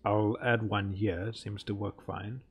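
ordinary talk

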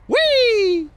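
high excited squeal sliding down; gleeful wee. Real, not acted. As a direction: whoop as you drop. Sliding down into the depths.

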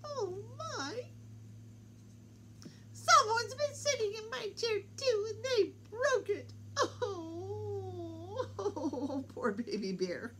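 very high little-bear wail, half laughing; tiny bear nearly crying over his chair; Baby Bear's voice. Acted. Baby Bear finding his broken chair, high teary squeak.

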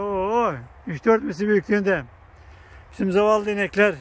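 ordinary delivery

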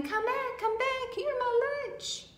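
high, loud chasing shout; high-pitched yell to come back; shouting after him. A narrator's reading. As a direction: call out shrieking high after a runaway.

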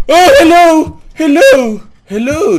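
high, loud, panicky cries; frightened crying out. Real, not acted. Scared player crying hello as the chair shakes. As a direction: cry out in fright, almost wailing. Frightened calling in horror game.

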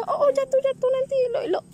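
high, loud anxious cry of "careful"; alarmed warning. Real, not acted. Mother sees her child about to fall, high alarmed cry.

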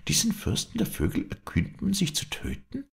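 high-pitched outraged question; indignant princess. Acted. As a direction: protest in high-voiced disbelief. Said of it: "rief sie ganz entrüstet". (entrüstete Prinzessin, hohe Stimme)

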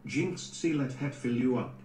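ordinary talk, nothing marked

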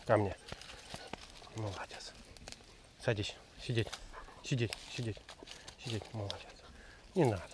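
quiet, gentle talk to the dog; calm the dog softly. Real, not acted. Тихо с собакой. (тихо и ласково)

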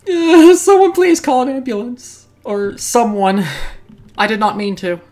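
hammed-up panic after the killing; mock panic. Player after killing the merchant, mock panic.

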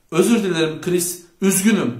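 understated tearful outcry; sobbing. A narrator's reading. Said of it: "diye hıçkırdı".

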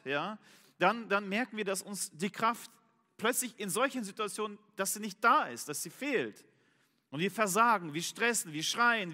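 fervent preaching. Acted. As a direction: preach it with building urgency. Preaches with rising force.

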